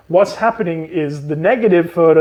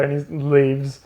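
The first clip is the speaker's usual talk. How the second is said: ordinary talk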